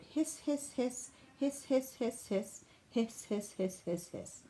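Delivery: hissing repeats that fade from high to soft; hissing cockroach poem. A narrator's reading. Cockroach reciting his poem, hissing and fading. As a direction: hiss it out like a cockroach, dying away. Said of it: "giant hissing cockroach wrote".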